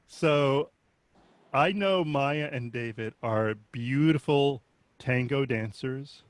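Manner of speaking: usual speaking voice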